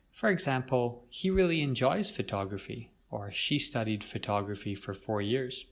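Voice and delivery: normal talking voice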